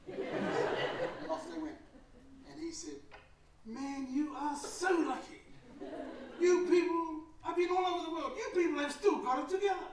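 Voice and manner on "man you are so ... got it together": high, loud, laughing Ali impression; imitating the champ. The champ telling the speaker how lucky he is, high laughing voice. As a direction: tease him like the champ, half laughing. Imitating Ali's envious praise.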